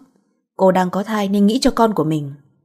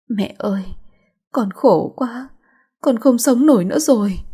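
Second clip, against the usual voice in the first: higher, broken crying voice; crying to mother; 4 semitones higher than usual. A narrator's reading. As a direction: sob it out to her mother. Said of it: "ôm lấy bà mà khóc". (khóc nức nở gọi mẹ, giọng cao)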